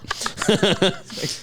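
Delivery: high-pitched falling laugh. Real, not acted. Laughing after quote.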